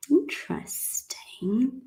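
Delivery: slow pondering whisper. Pretend. Teacher musing to learners, whispered. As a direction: murmur it like a sly aside. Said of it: "mock pondering the rule".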